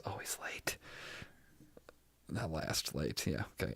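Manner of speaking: very soft, voiceless remark; whispered aside. Real, not acted. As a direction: slip it in under your breath. Muttered aside during the recap.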